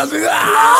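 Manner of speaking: high mock shriek of disgust. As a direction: shriek like you stepped on it. Mimics her disgusted scream.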